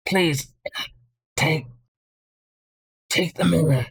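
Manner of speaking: halting dying plea with long gaps; labored breathing. Acted. Injured dwarf pleads with his rescuer, labored breathing. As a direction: struggle to get each word out. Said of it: "breathing is labored".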